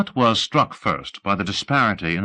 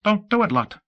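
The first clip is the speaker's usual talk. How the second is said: high, alarmed plea; horrified warning; 14 semitones higher than usual. Acted. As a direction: blurt the warning in horror, pitch jumping higher. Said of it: "was horrified".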